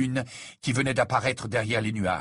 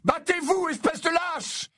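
furious shout. A narrator's reading. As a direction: yell the challenge with rage. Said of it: "lui cria Harry".

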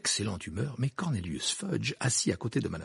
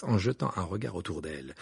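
ordinary delivery, nothing marked

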